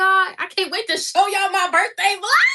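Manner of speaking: high, loud, thrilled announcement; excited shout. Real, not acted. Telling viewers about her birthday vlog, shouting with excitement. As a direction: yell it with joy.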